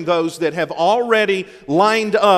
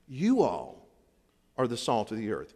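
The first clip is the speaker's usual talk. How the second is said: softened stress; 7 semitones lower, 8 dB quieter than usual. Real, not acted. Addressing the church directly.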